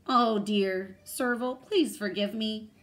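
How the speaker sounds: high, loud storybook pleading; blurted apology. A narrator's reading. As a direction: blurt out a plea for forgiveness. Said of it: "Then Dog blurted".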